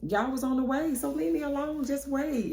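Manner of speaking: high, put-on voice brushing him off; friend telling him off. Friend telling her man to wait, high sassy. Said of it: "And I told him".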